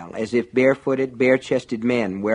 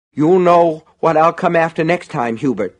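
raised, louder threat; menacing; 7 semitones higher, 6 dB louder than usual. A narrator's reading. Angry man quoting threatening note, menacing. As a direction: read out the threat, high and forceful.

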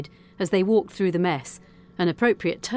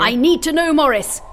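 loud, high insistence; captain cutting in forcefully; urgent demand; 9 semitones higher, 8 dB louder than usual. A narrator's reading. Captain pressing operator for an answer, urgent demand.

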